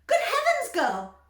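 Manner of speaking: very high, loud shriek; mother shrieking at Violet. A narrator's reading. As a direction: shriek in horror. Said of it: "shrieked Missus Beauregard".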